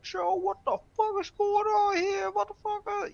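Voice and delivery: high-pitched Chicago "Joe" talk; Chicago slang impression. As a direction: act out a Chicagoan in a high, softened voice. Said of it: "we say Joe all the fucking time".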